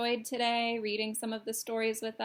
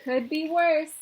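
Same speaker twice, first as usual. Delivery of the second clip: put-on louder, higher chorus of kids; kids' reply; 6 semitones higher, 9 dB louder than usual. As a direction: call it out like a bunch of kids.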